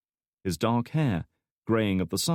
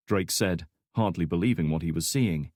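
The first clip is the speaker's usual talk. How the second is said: ordinary delivery, nothing marked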